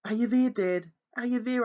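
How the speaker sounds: high loud calls; shouting. Acted. Boy shouting for his father in the wood, loud and high. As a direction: shout desperately into the night. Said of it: "I shouted".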